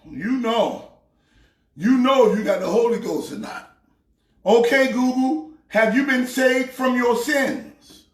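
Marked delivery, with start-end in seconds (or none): 4.4-8.0 repeating to voice assistant. Real, not acted.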